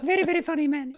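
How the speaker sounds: very high put-on Italian-accented voice; high caricature of an Italian climber, laughter creeping in; Simone Moro. Climber mimicking his funny Italian partner, very high imitated voice. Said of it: "Simone has this voice that is just epic".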